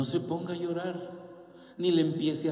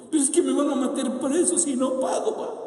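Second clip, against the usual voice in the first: put-on anxious child to father; 10 semitones higher, 4 dB louder than usual. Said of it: "Ahora ya es tu problema".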